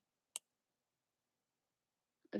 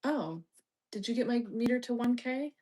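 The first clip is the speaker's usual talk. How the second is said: ordinary delivery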